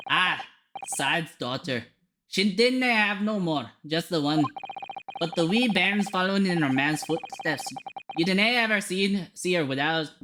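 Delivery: lively Scottish storytelling; Scottish character. Acted. Describing the bairn and her scalpel, Scottish accent. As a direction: reminisce as a Scot.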